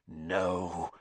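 low, soft hissed no. Acted. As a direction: hiss it through his teeth. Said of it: "he hissed".

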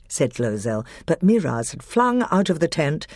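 ordinary delivery, nothing marked

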